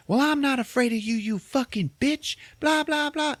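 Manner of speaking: high, strained mock yelling. Marcus yelling at Kathy Bates, hammed-up high rage. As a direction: squeal out the insults in a hammed-up rage. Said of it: "Marcus starts yelling at her".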